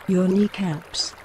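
ordinary talk, nothing marked

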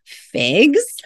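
mock-offended snap of a question; angrily. Dentist offered figs instead of money, indignant rising "Figs?". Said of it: "he said angrily".

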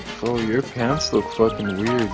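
ordinary talk

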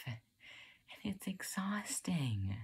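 soft, high, very slow and breath-thin; worn-out confession. Acted. Overwhelmed student admitting it's exhausting, softly drained. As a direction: let it trail out, drained.

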